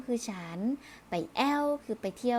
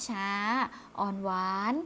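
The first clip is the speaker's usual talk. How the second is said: slow, sweet Northern drawl; 2 semitones lower, much slower than usual. Shown as a demonstration. Teacher demonstrating Northern Thai speech to pupils, slow and sweet. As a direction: show the Northern lilt, very slow. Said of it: "เสียงของคำจะลากยาว".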